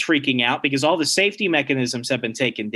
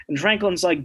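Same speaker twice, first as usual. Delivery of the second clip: ordinary talk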